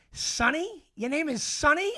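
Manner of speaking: high, incredulous questioning; mocking disbelief. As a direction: imitate dad ridiculing a rival. Dad mocks rival's name, acted.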